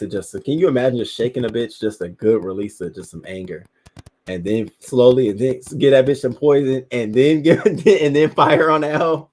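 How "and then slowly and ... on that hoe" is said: loud, laughing, climbing in pitch; gleeful venting. Real, not acted. Imagining revenge on the babysitter, gleeful and laughing. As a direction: pile it on, breaking into laughter.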